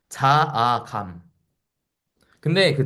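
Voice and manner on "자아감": each syllable drawn out slowly; 자아감 stretched syllable by syllable; slow, deliberate repeat. Shown as a demonstration. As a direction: say it again slowly so it sinks in. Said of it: "자아감이라고 부르기로 했어요".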